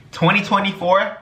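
high, hyped up talk; excited. Real, not acted. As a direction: pump it up, voice up high. Hyped about the year.

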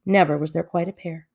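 ordinary delivery

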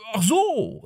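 high startled gasp of recognition; guilty start. A narrator's reading. Zephyr suddenly remembers the party, guiltily. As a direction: caught red-handed. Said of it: "mit schuldbewusstem Erschrecken".

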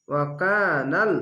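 ওয়াকানাল slow, with the কা stretched one alif; Quranic recitation demo. Shown as a demonstration. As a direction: draw out the কা in ওয়াকানাল. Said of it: "এক আলিফ টেনে পড়বেন". (কা এক আলিফ টেনে পড়া)

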